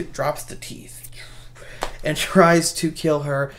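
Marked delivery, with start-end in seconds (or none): 0.0-1.1 breathy whispered aside. Retold or imitated. Retelling a horror scene, whispered aside. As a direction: drop to an unvoiced whisper.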